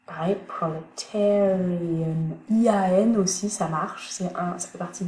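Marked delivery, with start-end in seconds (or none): 0.5-2.4 "proletarian" said slowly, stress on "-tar-"; slow stress demo. Shown as a demonstration. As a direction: model "proletarian", leaning on "-tar-". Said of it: "la syllabe juste avant, c'est yin". ("proletarian" lentement, accent sur "-tar-")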